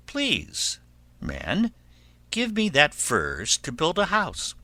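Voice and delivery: lightly voiced high piglet; pleading little pig. Pig asks man for furze, lightly voiced plea.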